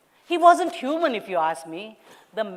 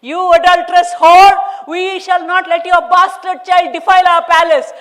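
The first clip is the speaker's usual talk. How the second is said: high, very loud screech; 10 semitones higher, 14 dB louder than usual. Acted. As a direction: screech the insult in rage. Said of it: "when Desai screeched".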